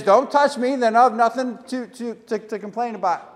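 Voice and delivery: high, loud mock alarm; mock frightened old man yelling; panicked protest. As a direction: back off in a hammed-up panic. [name] backs away panicked.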